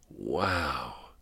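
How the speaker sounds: slow, drawn-out dry-throated words; groggy words from a dry throat. A narrator's reading. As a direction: draw it out through a parched throat. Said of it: "said through a dry throat".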